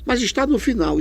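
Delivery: a hint of a puzzled student; imagined student. Teacher voicing a student's doubt, mildly puzzled.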